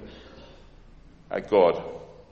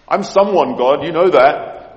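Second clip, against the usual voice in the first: a mock-proud boast, pitched higher, louder and slow; boastful pride; 5 semitones higher, 13 dB louder, slower than usual. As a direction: puff up like a self-important man reminding God. Paul boasting to God.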